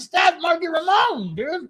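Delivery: high, stretched, loud exclamation; hammed-up retold shout, drawn out; incredulous punchline. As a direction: cry out the retort in disbelief. Roadie's retort as punchline.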